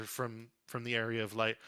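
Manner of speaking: ordinary talk, nothing marked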